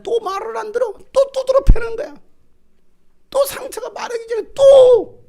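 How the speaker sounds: high, loud, sobbing cry. Real, not acted. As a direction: wail it with breaking voice. Cries out over God's pain. (높고 크게 울부짖는 목소리)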